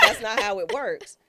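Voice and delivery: high, loud, laughing prim correction; laughing mock operator. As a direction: lecture her through laughter.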